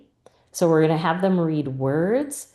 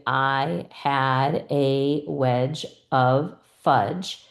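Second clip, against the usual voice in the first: slow, separated reading with each word on its own; 3 semitones lower, much slower than usual. Shown as a demonstration. Teacher models chip-by-chip reading for students, word by word. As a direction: read it one word at a time, slowly. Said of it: "put chips out and have them read".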